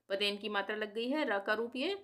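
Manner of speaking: ordinary talk, nothing marked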